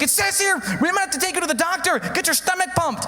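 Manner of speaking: high-pitched mock alarm; panicked wife. Wife reading overdose advice to husband, high worried voice. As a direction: imitate a wife fretting over bad news.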